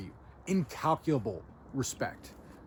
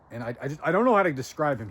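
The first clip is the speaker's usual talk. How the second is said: ordinary delivery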